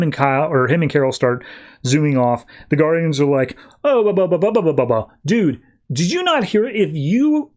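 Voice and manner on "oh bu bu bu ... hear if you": high stammering interruption; flustered objection. Retold or imitated. Guardians stopping Kyle as he zooms off, flustered. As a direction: stammer to cut him off, then protest high.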